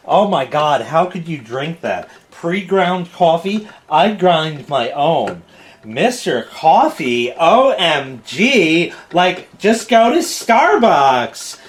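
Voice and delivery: slow, drawn-out mock snobbery; hammed-up coffee snob, rising in pitch at the end; scornful coffee fiend. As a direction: drag the words out as an appalled coffee purist. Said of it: "coffee fiends out there who are gonna say".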